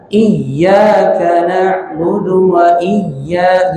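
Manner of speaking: "Iyyaka" with the double y pressed hard, then held; slow recitation leaning firmly on the yy of "Iyyaka"; pressed tasydid. Shown as a demonstration. Teacher showing how it should be read, pressed tasydid.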